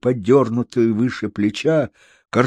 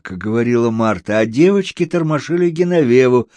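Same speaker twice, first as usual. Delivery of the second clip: usual speaking voice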